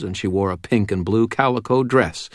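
plain reading voice

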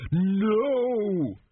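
long, high-pitched cry of alarm; bellowed. A narrator's reading. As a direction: bellow it out to stop him. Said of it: "Dios bellowed".

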